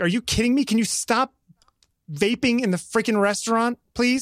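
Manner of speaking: high-pitched indignant telling-off; retold exasperated confrontation. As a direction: re-enact scolding a stranger, fed up. Retells snapping at the vaper.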